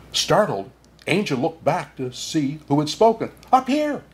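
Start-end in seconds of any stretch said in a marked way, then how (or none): 3.5-4.0 high little bird call; tiny bird voice. Acted. Bird calling to Anja from above, high. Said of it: "said the voice".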